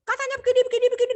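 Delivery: high, shrill-pitched accusing rant. Shown as a demonstration. Teacher acts a child accusing late parents, voice high. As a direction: blame them, voice way up. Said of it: "Nah, itu menuduh".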